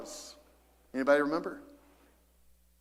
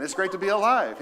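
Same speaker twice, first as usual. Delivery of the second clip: louder, higher retake; 5 semitones higher, 7 dB louder than usual. Shown as a demonstration. Pastor repeating the line louder for the congregation. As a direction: raise it up and proclaim it. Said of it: "Let's try that again".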